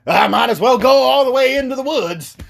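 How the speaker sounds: high, loud put-on voice; mock reckless friends. Friends decide to go into the woods, high mock bravado. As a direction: send it up in a high silly voice.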